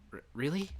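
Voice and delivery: acted shock; shocked stammer. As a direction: stumble on it in disbelief. Senpai shocked.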